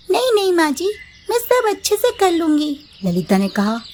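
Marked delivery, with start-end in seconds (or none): 0.1-2.8 higher, sweet obedient bahu; high young daughter-in-law promising obedience. Acted. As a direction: sound eager to please mother-in-law. Mamta refuses help.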